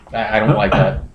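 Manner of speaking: ordinary talk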